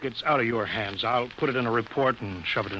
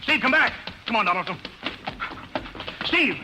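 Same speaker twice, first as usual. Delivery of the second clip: shouting after him; 11 semitones higher than usual. Acted. Officer yelling after the pilot running for the rocket. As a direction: yell at him to stop and come back.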